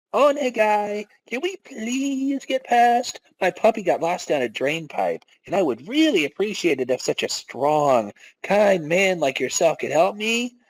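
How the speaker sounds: bat your eyes and beg; girly plea. Acted. Kimiyawa sweet-talking the guard, high and cutesy.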